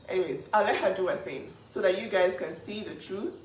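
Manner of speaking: ordinary talk, nothing marked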